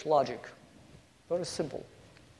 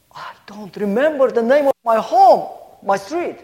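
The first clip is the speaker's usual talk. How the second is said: put-on a lost little girl's high, loud, tearful plea; crying child; 7 semitones higher, 8 dB louder than usual. Lost girl tells the policeman through tears. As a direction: cry it out, half-sobbing, louder and higher. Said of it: "And she kept crying".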